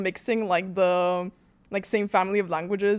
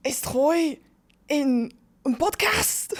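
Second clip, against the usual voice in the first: higher, slow, hammed-up German accent; 6 semitones higher, much slower than usual. Shown as a demonstration. Guest obeys host's request, singing-like mock German accent. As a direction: sing it out like German rock, heavy accent. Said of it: "Go German accent".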